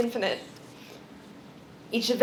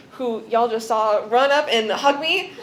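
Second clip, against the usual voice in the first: ordinary talk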